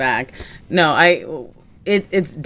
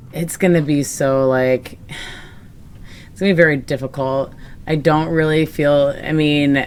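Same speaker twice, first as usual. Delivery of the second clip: ordinary delivery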